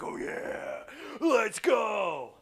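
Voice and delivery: soldiers cheering. Acted. Soldiers banging shields celebrating.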